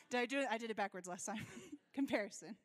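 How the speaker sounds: amused at your own slip, laugh through the words; real laughing. Real, not acted. Laughing at own mix-up on stage.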